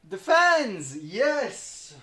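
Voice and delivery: high, loud excited cry; excited shout. Real, not acted. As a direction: shout it out with glee.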